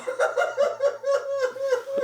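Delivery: shrill gleeful cackle; squealing hammed-up laugh; high cackle. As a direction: cackle like a mad host. Host laughs at own joke.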